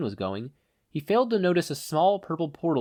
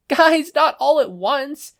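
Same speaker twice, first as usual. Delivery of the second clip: high, slow, loud overwhelmed shout; mental yell; 7 dB louder, much slower than usual. Acted. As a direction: shout over a crowd of voices. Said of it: "mentally yelled".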